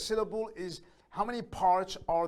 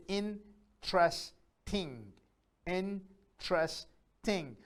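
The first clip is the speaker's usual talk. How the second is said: INTERESTING very slowly, in, tres, ting; long gaps between the three parts of interesting; split into syllables; 3 dB quieter, much slower than usual. Shown as a demonstration. Teacher isolating vowels in interesting, split into syllables. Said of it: "The number of vowel sounds".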